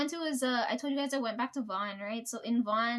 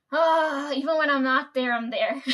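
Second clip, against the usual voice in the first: louder, half-laughing exasperation; loud exasperated exclamation; 7 dB louder, slower than usual. Real, not acted. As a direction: groan it out with a laugh. Exclaims about work following her.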